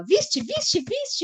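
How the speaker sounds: high-pitched, rising, giddy repeats; mock excited squeal. Host mimicking Silvia asking if she saw it, high excited squeal. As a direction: play the thrilled fan who can't wait. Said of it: "não expressei com toda a entonação de voz dela".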